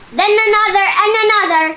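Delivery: high, loud and fast with delight; excited. A narrator's reading. Reading to a child, thrilled as puppies keep appearing. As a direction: rush it out, thrilled at each new puppy.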